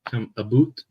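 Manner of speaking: Canadian accent, about as "a-boot". Shown as a demonstration. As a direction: lean into the Canadian "aboot". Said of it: "Making my Canadian accent show there".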